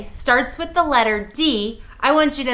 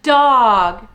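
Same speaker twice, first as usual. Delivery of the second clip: ordinary talk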